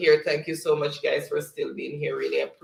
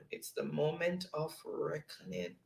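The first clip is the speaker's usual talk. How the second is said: hushed suspense; 14 dB quieter than usual. Acted. Building suspense before announcing winner.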